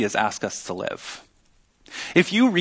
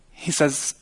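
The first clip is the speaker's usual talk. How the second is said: ordinary delivery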